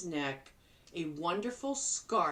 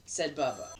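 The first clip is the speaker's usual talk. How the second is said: ordinary delivery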